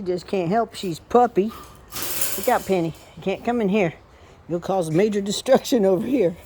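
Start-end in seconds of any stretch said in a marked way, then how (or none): none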